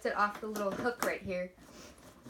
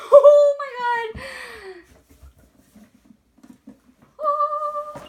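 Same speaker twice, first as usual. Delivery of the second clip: high, loud, slow squeal; excited shriek; 12 semitones higher, 13 dB louder, much slower than usual. Real, not acted. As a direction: squeal with joy. Opening the suitcase, overwhelmed.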